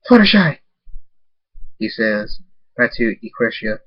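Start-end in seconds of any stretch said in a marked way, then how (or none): 0.1-0.6 loud, higher call of a name. Acted. David waking on the roof, calling loud for Fluttershy. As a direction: cry out her name in alarm.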